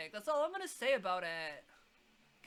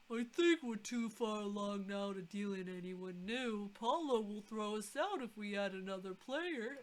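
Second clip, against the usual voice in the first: character voice. Acted. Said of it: "voicing game character".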